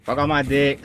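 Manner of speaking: ordinary talk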